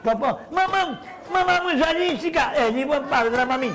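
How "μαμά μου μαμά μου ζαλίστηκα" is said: high, fast cry for mama; mock dizzy panic. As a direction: squeal like a kid spinning too fast. Dizzy on the swing, cries out.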